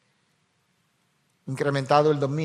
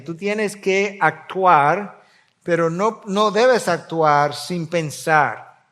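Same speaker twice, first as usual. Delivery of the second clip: louder, insistent sermon reading; fervent preaching; 3 semitones higher, 6 dB louder, slower than usual. Acted. Pastor urging the congregation to think, louder preaching. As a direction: press the verse on the flock, slowed at the end.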